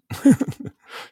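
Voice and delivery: sudden high, loud laugh; laughing out loud at dad's advice; burst of laughter. Real, not acted. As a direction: crack up at the memory. Laughs at own dad story.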